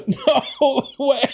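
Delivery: high laugh through the words; disbelieving laugh. Real, not acted.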